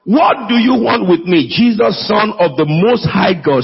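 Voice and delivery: ordinary talk, nothing marked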